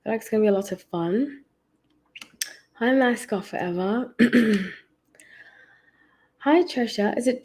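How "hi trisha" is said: normal talking voice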